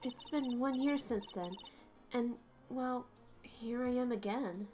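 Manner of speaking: quiet resolve, inward; inner monologue. A narrator's reading.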